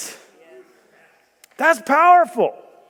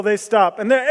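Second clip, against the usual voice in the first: usual speaking voice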